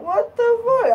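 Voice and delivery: sudden high-pitched exclamation; shocked realization. Retold or imitated. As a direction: let it jump up in disbelief. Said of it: "I was surprised".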